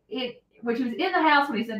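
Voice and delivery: ordinary delivery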